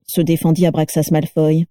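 usual speaking voice

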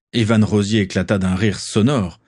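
ordinary delivery

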